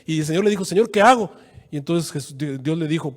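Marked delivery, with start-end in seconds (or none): 0.6-1.3 mock plea, higher and louder; a hint of a desperate Moses; pleading Moses. Moisés clama a Dios. (Moisés suplicando, más agudo y fuerte)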